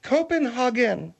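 "Copenhagen" with an "ah" in "hagen"; Germanic pronunciation. Shown as a demonstration. Repeating it on request for a puzzled listener, Germanic pronunciation. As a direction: say "Copenhagen" as koh-pen-HAH-gen. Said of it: "say it again?".

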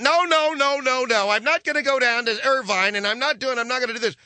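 high, mocking refusal of the mother; whiny mom on phone. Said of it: "the mother is there on the phone".

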